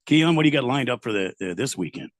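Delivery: normal talking voice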